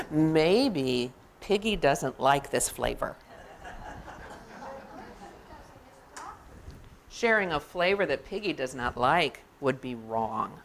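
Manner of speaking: low, deliberate storybook musing; elephant reasoning to himself. Acted. Elephant talking himself out of sharing, deliberate and low. Reading elephant's self-justifying thoughts.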